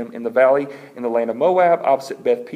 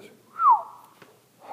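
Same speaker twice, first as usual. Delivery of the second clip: high whistled phew; relieved whew. Acted. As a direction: blow out a high sigh of relief. Moses relieved after water came.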